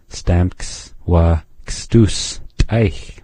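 Stamks wa kstuch said very slowly, syllable by syllable; teacher slowing right down; slow demo. Shown as a demonstration. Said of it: "Hear that ending change?".

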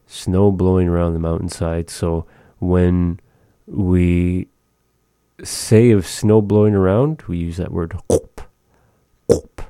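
ordinary talk

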